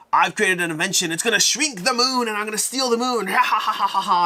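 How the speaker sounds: loud, high mock villain boast into cackle; hammed-up evil villain. Villain showing off his moon invention on stage, hammed-up evil gloating. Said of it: "they're having an evil contest".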